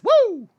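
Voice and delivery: loud, high-pitched "woo"; excited whoop. Real, not acted. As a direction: let out a hype whoop. Hyping up the show's start.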